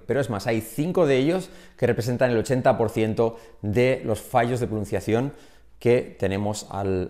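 ordinary delivery

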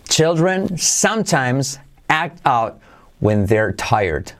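very slow, word by word; slow model sentence. Shown as a demonstration. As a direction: say it slowly for learners to repeat. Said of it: "Velocidad normal". (despacio, palabra por palabra)

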